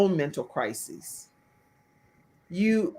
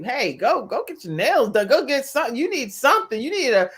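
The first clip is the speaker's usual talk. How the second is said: higher, animated imitation of a husband nagging; playful urging; 5 semitones higher, 6 dB louder than usual. Imitating husband sending wife to get pampered, playfully insistent. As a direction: rattle off orders in a lively, higher voice.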